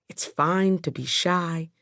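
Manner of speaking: ordinary delivery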